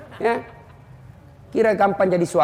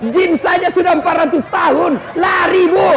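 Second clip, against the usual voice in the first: punchline shout; 8 semitones higher, 4 dB louder than usual. Real, not acted.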